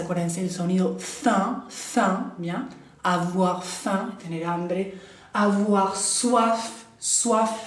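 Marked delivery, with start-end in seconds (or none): 5.3-7.7 soif said slowly as swaf with the final F clearly sounded; slow pronunciation demo. Shown as a demonstration. Teacher drilling soif for students, final F sounded. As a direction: model soif slowly, landing the F. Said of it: "ahí se pronuncia la F".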